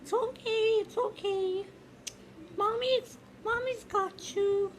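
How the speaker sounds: high, soft mommy voice; soothing a child. Acted. Mother comforts the baby.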